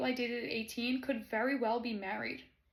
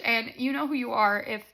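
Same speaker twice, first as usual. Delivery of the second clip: normal talking voice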